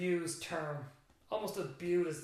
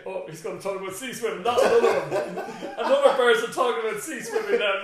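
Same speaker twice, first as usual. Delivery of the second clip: laughing through a mock groan, louder; mock listener voice; 6 semitones higher, 12 dB louder, faster than usual. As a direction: poke fun at himself as a bored listener would. Mocking an imagined bored listener.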